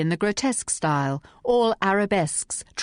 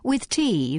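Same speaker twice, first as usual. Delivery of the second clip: ordinary delivery, nothing marked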